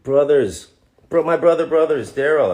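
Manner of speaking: high, loud hail of brothers; calling out. Real, not acted. Actor hails a fan named Daryl, high and loud. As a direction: shout a hearty hello across the room.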